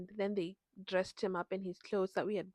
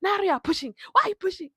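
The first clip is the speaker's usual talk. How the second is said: high, loud mock doctor asking why she is pushing; doctor catching her pushing early, high and loud; doctor's alarmed question; 9 semitones higher, 9 dB louder than usual. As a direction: play the startled doctor, pitched up and louder. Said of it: "he's like".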